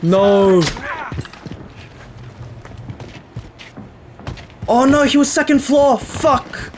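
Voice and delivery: sudden alarm, voice jumps up; alarmed excitement. Real, not acted. Gamer spots the hacker upstairs, voice jumps in alarm. Hacker found on second floor.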